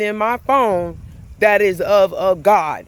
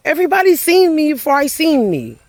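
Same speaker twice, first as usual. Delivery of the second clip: emphatic realisation; 8 semitones higher, faster than usual. Real, not acted. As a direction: lift it high, hit seen both times. Repeats the realisation louder.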